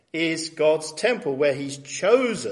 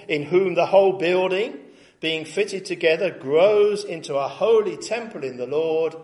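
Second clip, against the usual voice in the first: fervent scripture reading; 4 semitones higher, 6 dB louder than usual. Acted. Reads Ephesians with fervour.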